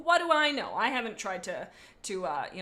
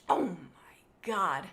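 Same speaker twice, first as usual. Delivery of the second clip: breathy whispered gasp of awe; awed whisper; 5 semitones lower, much slower than usual. Acted. Chaser whispers to himself, newly risen from death, awed. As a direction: whisper it, slowly, in wonder. Villain cat awakens, whispered.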